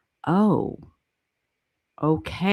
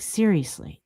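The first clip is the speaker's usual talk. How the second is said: ordinary delivery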